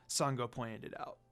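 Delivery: plain reading voice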